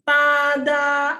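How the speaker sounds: TADA drawn out high and level; loud TADA held on one high pitch; surprise reveal TADA. Shown as a demonstration. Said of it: "memberikan kejutan kepada seseorang".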